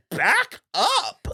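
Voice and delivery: high-pitched, exaggerated warning; retold indignant outburst; mock exasperated order. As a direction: push them away with a high yelp. Retold reaction to actors crowding Alice.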